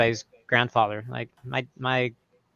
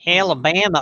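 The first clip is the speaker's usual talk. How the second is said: put-on Alabama accent; 4 semitones higher than usual. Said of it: "really deep Alabama".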